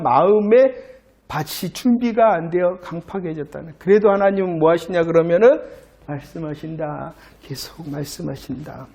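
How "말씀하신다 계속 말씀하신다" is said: much softer, lower voice; hushed reflection. Real, not acted. Preacher dwells on God still speaking, hushed and reflective. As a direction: let it sink in quietly.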